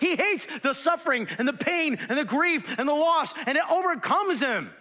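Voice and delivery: loud, high, building to full force; impassioned preaching. Real, not acted. Preacher on Jesus hating death and pain, impassioned full force. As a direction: hammer each repeated phrase harder.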